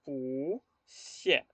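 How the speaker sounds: "pu xie" split, long rising "pu", pause, falling "xie"; second-tone pu. Shown as a demonstration. Teacher guiding the class, exaggerated rising "pu" before "xie". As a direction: draw "pu" upward, wait, drop "xie". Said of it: "Vamos a decir".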